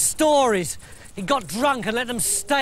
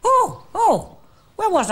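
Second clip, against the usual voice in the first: high, louder jolt back to himself; startled waking; 11 semitones higher, 3 dB louder than usual. Acted. Laban snapping out of the trance, startled and high. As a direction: snap awake with a yelp. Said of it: "he snapped right out of it".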